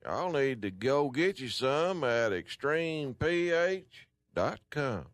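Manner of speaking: ordinary delivery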